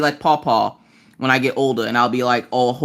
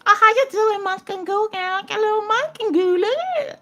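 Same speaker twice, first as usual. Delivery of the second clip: put-on very high cooing baby talk. Talking to a small child, squeaky baby voice. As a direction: coo at a little kid way up high. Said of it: "the little baby voice".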